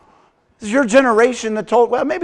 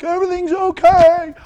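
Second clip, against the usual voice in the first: forced cheer; 10 semitones higher, 5 dB louder than usual. Retold or imitated. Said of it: "and I'm like".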